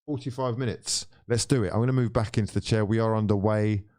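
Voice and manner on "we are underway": usual speaking voice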